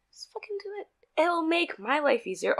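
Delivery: hushed exasperated whisper; whispered aside. Acted. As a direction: drop to a fierce whisper. Muttered aside about rude customers.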